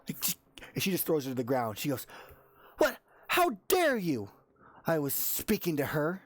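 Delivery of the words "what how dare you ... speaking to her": indignant. Acted. Volna shoves a rival aside and confronts her, indignant. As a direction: demand an answer, pitch jumping up.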